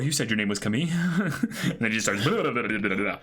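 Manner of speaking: normal talking voice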